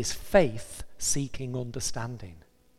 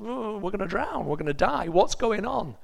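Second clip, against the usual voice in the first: panicky sailors; 7 semitones higher than usual. Retold or imitated.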